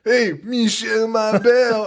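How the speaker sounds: high, drawn-out taunting call; mock stepdad. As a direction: imitate a stepdad jeering a nickname. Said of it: "he's like".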